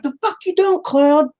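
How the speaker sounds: very high, hammed-up screech; mock angry retort. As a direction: snap back in pretend rage, voice way up. Acted angry retort to cloud.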